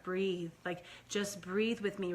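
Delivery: soft, slow, tender breathing cue; calm her sick baby with a gentle murmur; mother soothing her son into his ear, soft and slow; soothing and loving. Retold or imitated. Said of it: "very calm and loving and soothing".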